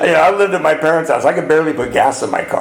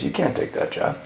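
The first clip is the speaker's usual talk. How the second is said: "You can't take that job" breathed, unvoiced and much softer; hushed repeat; 9 dB quieter than usual. Brother's verdict retold in a hushed whisper. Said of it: "And he goes".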